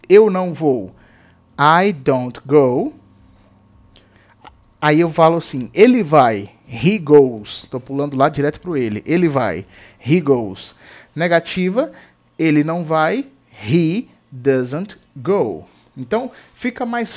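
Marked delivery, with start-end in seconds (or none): 1.6-2.9 very slow, each word set apart for students; slow teacher demo of a sentence; slow model sentence. Shown as a demonstration. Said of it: "teacher models the sentence". 13.7-15.7 very slow, each word set apart for students; slow teacher demo of a sentence; slow model sentence. Shown as a demonstration. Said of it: "teacher models the sentence".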